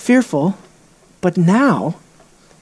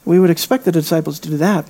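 ordinary talk

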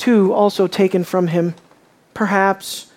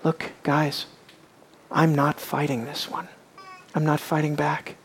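soft, low-spoken calm resolve; quiet Jesus voice; 7 semitones lower, 11 dB quieter than usual. Retold or imitated. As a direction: speak to the disciples softly, no fight left. Voicing Jesus to his disciples.

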